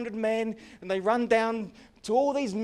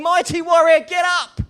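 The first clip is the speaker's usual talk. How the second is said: much louder and higher, ringing out; booming call; 10 semitones higher, 15 dB louder than usual. Acted. Heaven answering the cowering listener with a ringing shout. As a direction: call him up with full force.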